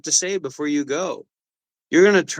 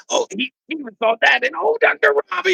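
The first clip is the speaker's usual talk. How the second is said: much higher, fast gushing mock voice; fawning fan; 11 semitones higher, faster than usual. Mocking a fan gushing over Doctor Robbie, high and fast. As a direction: gush like a starstruck fan.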